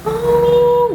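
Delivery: high, drawn-out ghostly "Oh"; a spooky, high held "Oh" like an unseen ghost; eerie ghostly moan. Retold or imitated. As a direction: imitate the strange moaning sound heard at night. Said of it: "Ada suara".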